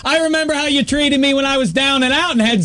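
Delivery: high-pitched spurned-man rebuff; mock indignant. Host scolding the voicemail lady, mock indignant.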